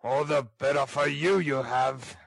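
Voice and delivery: surly ruffian's growl; menacing robber voice. Acted. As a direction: growl it as a grudging threat. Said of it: "growled Sikes".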